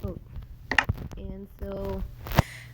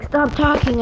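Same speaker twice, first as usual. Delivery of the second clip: high, loud mock teacher order; raised-voice imitation of a telling-off; retold scolding; 7 semitones higher, 6 dB louder than usual. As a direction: imitate a teacher snapping at the class.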